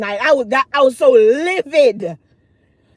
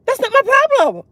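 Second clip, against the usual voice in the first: laughing it out, much higher; 13 semitones higher, faster than usual. Real, not acted. Telling viewers the comeback to her cousin, laughing. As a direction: blurt it through a laugh.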